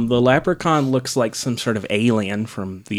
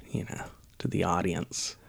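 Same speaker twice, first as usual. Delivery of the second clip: a quick, hushed whispered aside; 3 semitones lower, 12 dB quieter than usual. Real, not acted. Podcast host mocking the film's tagline, whispered aside. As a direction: drop to a whisper for the last bit. Aside about the movie's insult.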